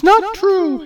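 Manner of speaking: very high, loud, slow retort; Hera cutting in. Acted. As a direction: interrupt him haughtily. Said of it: "Hera cut in".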